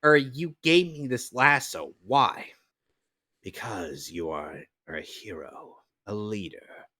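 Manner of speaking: grand cartoon narrator, then hushed praise; dramatic cartoon voice. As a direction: announce it like a superhero show. Dubs cartoon heroes and narrator.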